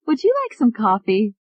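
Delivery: high, eager secretary; lightly voiced young woman. A narrator's reading. Secretary offers coffee.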